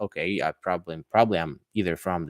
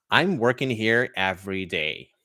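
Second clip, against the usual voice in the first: working with a clear, pronounced American R; American accent. Shown as a demonstration. English teacher demonstrating accents to students, American accent. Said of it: "more like an American version".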